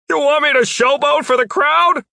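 loud, high-pitched question; high, projected, disbelieving yell; incredulous shout. A narrator's reading. Jeff shouts back in disbelief.

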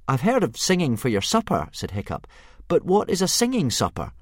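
a boy bravely playing for time; young Hiccup.

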